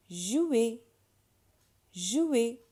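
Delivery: "Jouer" as zhoo-ay with a w glide; little w sound. Shown as a demonstration. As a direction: model "Jouer" high and loud, gliding zhoo into ay. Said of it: "a little w sound".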